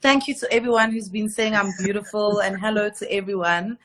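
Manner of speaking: ordinary delivery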